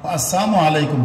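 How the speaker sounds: "আসসালামু আলাইকুম" as "as-saamu alaikum"; laam left out. Shown as a demonstration. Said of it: "লাম অক্ষর বাদ দিলে".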